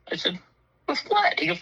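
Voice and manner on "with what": very high, rising disbelief; incredulous question. Retold or imitated. Resident to police chief about shot car, incredulous. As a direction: retell being taken aback, pitch leaping up.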